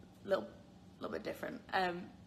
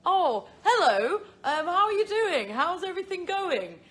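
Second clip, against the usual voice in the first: high, loud, hammed-up British accent; mocked British accent; 9 semitones higher, 9 dB louder than usual. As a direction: imitate someone teasing a British accent, pitched way up. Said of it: "make fun of my accent".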